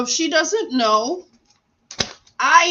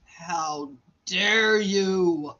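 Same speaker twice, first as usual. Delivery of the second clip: very slow, lower mock rebuke; drawn-out indignation; 3 semitones lower, much slower than usual. Confronting the doctor who walked out, very slow drawn-out indignation. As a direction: stretch every word in hammed-up outrage.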